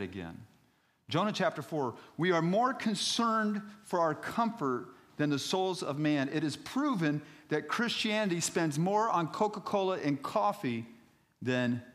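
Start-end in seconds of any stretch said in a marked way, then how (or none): 1.1-11.8 raised, high-pitched sermon voice; loud, insistent pulpit delivery; emphatic preaching. Real, not acted. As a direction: hammer the point home to the congregation. Preaches, repeating his point.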